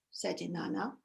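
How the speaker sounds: usual speaking voice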